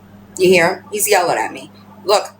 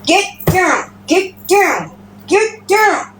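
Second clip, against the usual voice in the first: high, insistent orders to a cat; scolding commands; 7 semitones higher, much slower than usual. Real, not acted. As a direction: order the pet off, pitched up each time.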